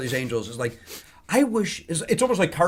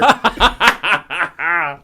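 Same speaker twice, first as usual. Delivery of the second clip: loud burst of laughter; real, delighted cackling laugh; big laugh; 4 semitones higher, 7 dB louder than usual. Real, not acted. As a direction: crack up after the punchline. Laughs after his own performance.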